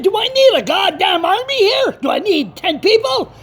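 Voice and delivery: high, agitated retort; indignant outburst. Retold or imitated. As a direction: fire back high in disbelief. Acted argument with the caller.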